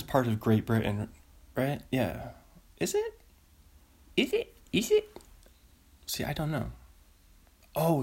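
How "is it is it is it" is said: high squeaky disbelief; incredulous. Real, not acted. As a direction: shoot each one up high in disbelief. Incredulous repeated question.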